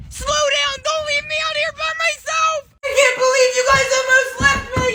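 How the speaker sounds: high, shrill-pitched frantic shouting; panicked yell. Acted. Boy left behind yells after fleeing friends, high and frantic. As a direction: scream after friends running off.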